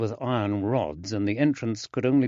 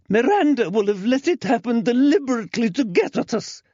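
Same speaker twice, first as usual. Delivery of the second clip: shrill accusation; 14 semitones higher, 6 dB louder, faster than usual. Acted.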